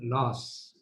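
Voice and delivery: "Laws" said singly, slow and low; careful pronunciation. Shown as a demonstration. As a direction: pronounce "Laws" carefully as a model, softly. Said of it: "I'm pronouncing correctly only".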